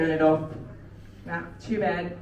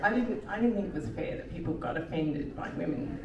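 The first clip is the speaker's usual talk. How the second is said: ordinary talk, nothing marked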